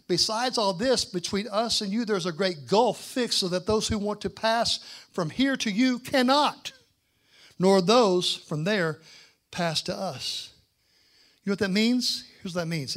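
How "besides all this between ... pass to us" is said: slow, loud, rising scripture reading; preacher reading the verse with full force; preaching scripture. Acted. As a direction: proclaim every word slowly.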